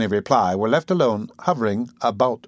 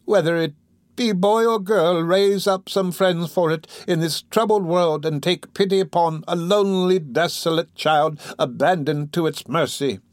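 slow, higher-pitched, imploring prayer; dying woman praying; 6 semitones higher than usual. Acted. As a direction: beg heaven slowly for the child. Dying woman recites mother's prayer.

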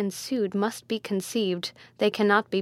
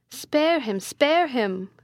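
higher-pitched pleading cry; 4 semitones higher, 3 dB louder, slower than usual. A narrator's reading. Attendants beg the prince for mercy, crying out. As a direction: call out an urgent appeal. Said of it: "cried the attendants".

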